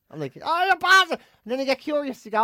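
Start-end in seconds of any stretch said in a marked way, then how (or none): 0.4-1.2 high, loud exasperated cry; exasperated outburst. Retold or imitated. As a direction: throw up your hands at the phone. Exasperated at Siri.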